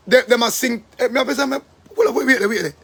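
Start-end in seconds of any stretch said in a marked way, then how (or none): none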